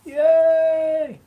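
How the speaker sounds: long, high cheerful yay. Real, not acted. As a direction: cheer in a high sing-song. Cheer at finished food.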